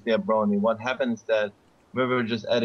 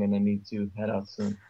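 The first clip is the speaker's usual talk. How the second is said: ordinary talk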